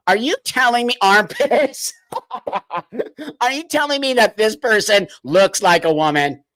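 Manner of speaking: incredulous question breaking into laughter; words interrupted by helpless laughs; real laughing. Real, not acted. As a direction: crack up while asking. Cracking up while questioning viewers.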